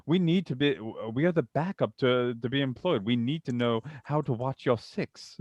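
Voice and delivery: British officer addressing comms; British accent. Acted. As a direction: plead the case in put-on British English. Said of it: "putting on the British accent".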